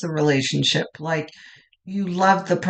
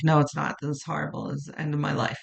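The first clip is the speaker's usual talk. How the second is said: hammed-up mock despair, softer and lower; exaggerated despair; 5 semitones lower, 10 dB quieter, much faster than usual. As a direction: play the sulky client, overdoing the gloom, fading softer. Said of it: "I'm exaggerating".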